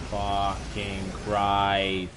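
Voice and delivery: a slow, stretched curse, fucking Christ dragged out; drawn-out groan. Real, not acted. Groaning to himself after losing again, slow dragged curse. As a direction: let it sink out of you as a groan.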